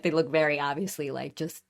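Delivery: ordinary talk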